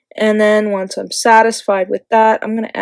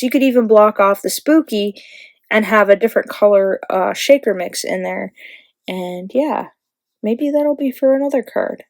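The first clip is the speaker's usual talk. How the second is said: ordinary delivery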